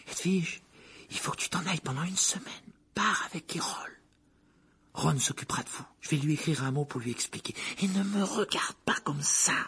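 put-on high, whispery boy's voice; boy hushing owl. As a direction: whisper apologetic instructions to a pet.

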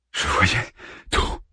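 slow, whispered daze; slow, awed whisper; dazed whisper. A narrator's reading. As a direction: let it drift out, still entranced. Harman still dazed.

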